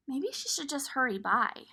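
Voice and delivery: plain reading voice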